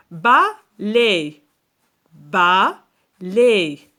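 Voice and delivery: loud, separated ba... lai; syllable-by-syllable. Shown as a demonstration. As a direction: split balai into two stretched beats. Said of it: "Combien de syllabes".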